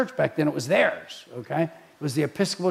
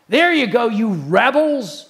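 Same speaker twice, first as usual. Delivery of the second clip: angry scolding; 4 semitones higher, 4 dB louder than usual. Acted. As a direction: scold an unruly crowd, stretching the last words. Voicing Moses scolding as he strikes.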